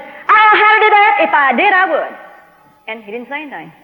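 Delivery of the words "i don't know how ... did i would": loud, high-pitched exasperated outburst; mad. Retold or imitated. Woman loses patience with a silent God, angry. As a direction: yell it in frustration at getting no answer. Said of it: "finally, I got mad".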